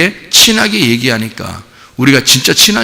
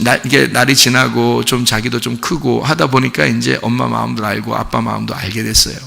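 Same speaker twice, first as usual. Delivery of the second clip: usual speaking voice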